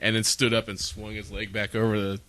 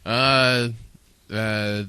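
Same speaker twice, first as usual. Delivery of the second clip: ordinary delivery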